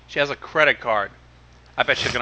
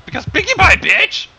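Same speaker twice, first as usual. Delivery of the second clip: mock exclamation; 9 dB louder than usual. Mocking echo of the catchphrase.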